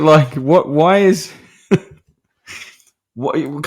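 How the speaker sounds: usual speaking voice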